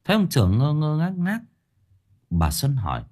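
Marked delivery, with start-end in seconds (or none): none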